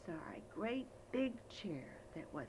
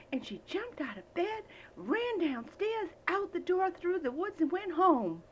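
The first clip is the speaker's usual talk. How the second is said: higher, louder breathless narration; higher, louder excited storytelling; excited telling; 9 semitones higher, 7 dB louder than usual. A narrator's reading. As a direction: rush the escape with excitement.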